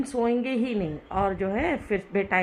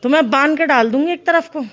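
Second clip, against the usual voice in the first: loud, raised-pitch telling-off; scolding threat; 7 semitones higher, 9 dB louder than usual. Real, not acted. Mother tells off troublemaking child, scolding.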